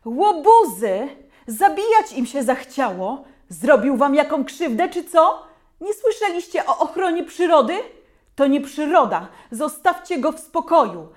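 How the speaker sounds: loud, high angry scolding; mother shouting. A narrator's reading. Mother scolding the mob from the porch, loud angry shouting. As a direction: tear into the crowd like a furious mom. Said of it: "umie krzyczeć głośniej niż najpotężniejszy głośnik".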